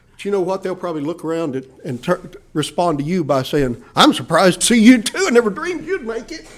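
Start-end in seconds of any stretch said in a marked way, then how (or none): 3.9-6.5 mock retort. Retort in heaven, mocking.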